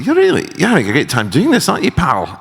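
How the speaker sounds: hammed-up Scottish brogue as a mock James McAvoy; bad Scottish accent. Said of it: "that's a very bad Scottish accent".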